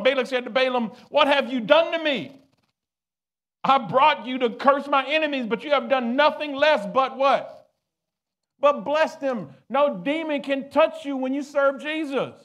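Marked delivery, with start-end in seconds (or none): 1.1-12.3 high, loud preaching roar; furious shout. Acted. King Balak shouts at Balaam for blessing enemies, loud furious. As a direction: keep shouting at full force.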